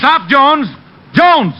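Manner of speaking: high shouted call after a running man; urgent chase yell. Acted. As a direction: yell his name to stop him. Chasing Jones on foot.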